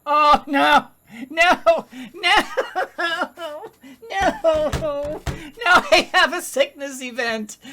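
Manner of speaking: loud, high wail with laughter; dismayed cry. Real, not acted. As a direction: cry out in helpless alarm. Dismay at a sickness event.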